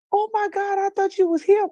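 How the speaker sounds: gasping high-pitched mock alarm; retold shock. Niece realizing she mistook the caller, retold shock. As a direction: imitate a niece gasping at her own slip.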